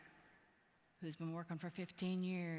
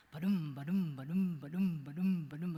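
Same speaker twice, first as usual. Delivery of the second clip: booming sound; 3 semitones lower, 4 dB louder than usual. Retold or imitated. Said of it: "It got louder and louder".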